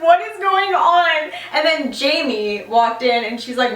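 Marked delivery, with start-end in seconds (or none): 0.0-1.3 mock-distressed laughing. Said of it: "I sat there crying".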